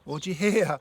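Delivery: ordinary talk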